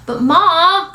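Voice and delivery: put-on high, loud boyish whine; whining. Boy protesting nap to his mother, whining. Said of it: "Kermit whines".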